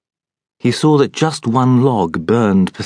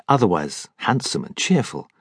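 ordinary delivery, nothing marked

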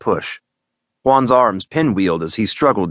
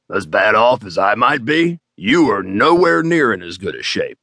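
gruff quip. Acted. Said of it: "Gerald quipped".

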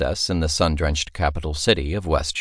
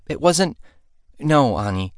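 high, flustered protest; bashful denial, cheeks going red; 9 semitones higher than usual. Acted. Girl blushing as the princess teases her, flustered.

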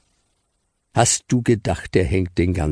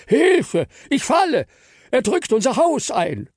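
high, loud panicky squeal; tiny voices crying for help; panicked cries; 4 dB louder than usual. Acted. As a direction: shriek for help as if falling. Chocolates cry out in panic.

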